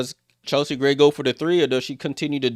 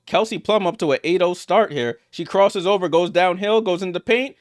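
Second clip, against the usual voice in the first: higher, driving commentary; excited play-by-play; 5 semitones higher than usual. Real, not acted. Commentator follows a player into the paint, excited. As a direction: follow the drive with mounting energy.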